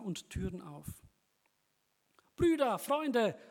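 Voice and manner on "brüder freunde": slow, high, loud call. Acted. Man calls friends to help him clean, loudly. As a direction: call them over from the window. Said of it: "rief er".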